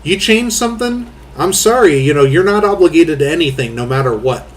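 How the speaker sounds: ordinary delivery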